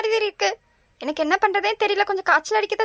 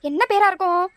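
usual speaking voice

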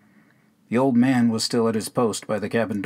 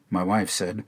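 plain reading voice

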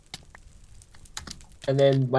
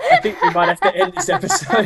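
high, loud words squeezed through laughter; helpless laughter while talking; laughing hard; 4 dB louder than usual. Real, not acted. Host giving up on the episode, laughing hard.

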